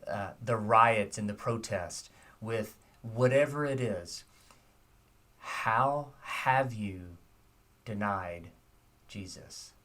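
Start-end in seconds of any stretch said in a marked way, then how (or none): 5.4-9.7 very slow, drawn out, ending in a breathy fade; slow it right down and let the last word fall; slow searching question. Shown as a demonstration. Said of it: "I want you to think about it".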